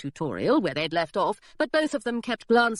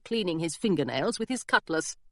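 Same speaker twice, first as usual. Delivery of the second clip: usual speaking voice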